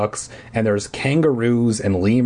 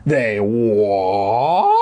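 squeaked. Acted. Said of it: "I squeaked".

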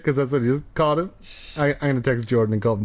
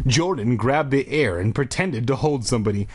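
ordinary delivery

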